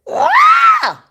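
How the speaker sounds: sharp high exasperated shriek; frustrated shriek. Real, not acted. Vlogger shrieks at viewers who cannot hear her. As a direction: let out a loud shriek of frustration. Frustrated by broken stream sound.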